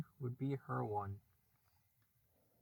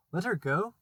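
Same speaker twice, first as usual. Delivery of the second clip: a higher, louder disbelieving echo; incredulous cry; 5 semitones higher, 7 dB louder than usual. A narrator's reading. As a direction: throw the words back in shock. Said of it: "cried Hook".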